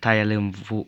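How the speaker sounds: usual speaking voice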